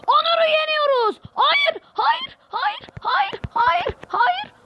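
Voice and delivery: very high, strained repeated hayır; despairing shriek. Real, not acted. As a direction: shriek no over and over as it slips away. Gamer about to win, then concedes.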